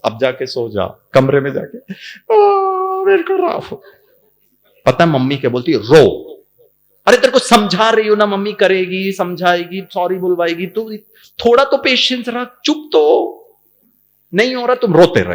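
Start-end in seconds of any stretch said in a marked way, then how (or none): 2.3-3.8 high, loud mock child sob; crying child. Little boy crying to his mother, high mock sob. 5.8-15.3 loud, fast exasperated scolding; scolding mother. Retold or imitated. Fed-up mother scolding her crying son, loud.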